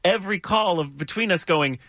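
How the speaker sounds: ordinary talk, nothing marked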